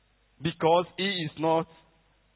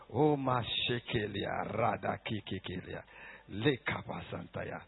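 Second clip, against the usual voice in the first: speaking in tongues; 12 semitones lower, 14 dB quieter than usual. Real, not acted. Preacher praying in tongues to the congregation, low murmur. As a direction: pray in tongues under the breath.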